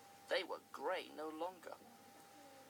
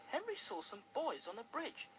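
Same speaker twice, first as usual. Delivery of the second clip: ordinary delivery, nothing marked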